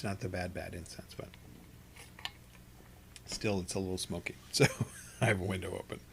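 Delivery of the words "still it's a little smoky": ordinary talk, nothing marked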